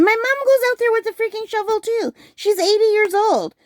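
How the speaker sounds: high, worked-up complaint; exasperated high pitch. Real, not acted. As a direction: voice jumping up in disbelief. Exasperated about mom shoveling.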